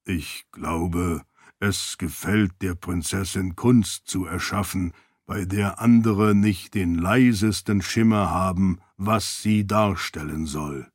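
solemn spirit voice, dry mockery underneath; deep solemn voice. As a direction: speak like a dignified ancient spirit. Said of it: "mit seiner tiefen, erhabenen Stimme".

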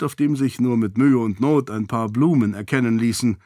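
ordinary delivery

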